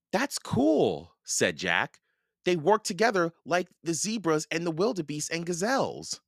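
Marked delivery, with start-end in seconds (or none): 0.1-1.1 put-on high-pitched, impressed kid; impressed child. A narrator's reading. Boy admires bird partnership in story. 2.4-6.2 put-on high-pitched boy, keen on his discovery; eager boy Jack. A narrator's reading. Narrator voices Jack.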